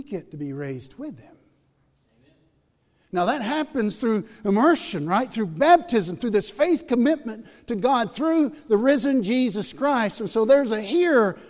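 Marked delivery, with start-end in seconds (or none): 3.1-11.4 louder, higher preaching voice; full-force preaching. Acted. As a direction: preach it out to the room.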